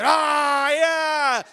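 high, loud mock crowd roar; erupting cheer. Class erupting when Kyle is named, wild cheering. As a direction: imitate a room bursting into cheers. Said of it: "And the room erupted".

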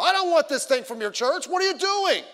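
loud, high-pitched angry protest; yelling. Retold or imitated. Angry man at the door to church inviter, yelling. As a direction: imitate an irate householder yelling at a caller. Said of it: "He started yelling".

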